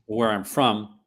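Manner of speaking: ordinary talk, nothing marked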